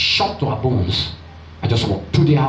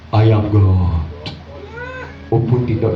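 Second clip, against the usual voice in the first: voice of God; 3 semitones lower than usual. Acted. God calls on a strange phone, deep solemn voice. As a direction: play God, deep and weighty.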